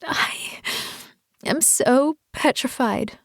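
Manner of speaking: slow, a touch of crying; through cries. Acted. Woman confessing her fear to her husband, mild tearful. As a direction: let the words break slowly as she sobs. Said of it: "I spoke through my cries".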